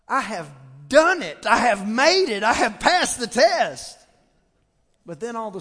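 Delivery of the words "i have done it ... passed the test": triumphant thought. Retold or imitated. Triumphant inner thought, church story.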